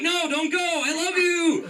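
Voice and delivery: high, slowed, desperate plea; mock pleading. Begging the leaving woman, a hammed-up plea. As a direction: beg her like a lovesick fool.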